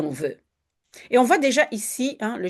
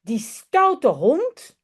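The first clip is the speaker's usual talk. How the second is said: slow mock scold, a touch higher; 7 semitones higher, slower than usual. A narrator's reading. Children scolding the dog that ate the pancake, slow mock scold. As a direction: drag out mild disapproval.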